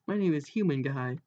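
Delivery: ordinary delivery, nothing marked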